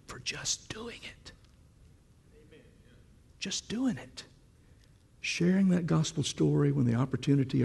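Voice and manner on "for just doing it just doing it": hushed unvoiced whisper, slow. Real, not acted. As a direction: lean in and whisper it. Sudden whisper to congregation.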